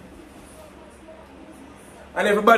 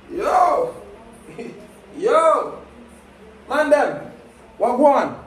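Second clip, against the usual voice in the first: calling out; 10 semitones higher, much slower than usual. Real, not acted. Calling out to mates.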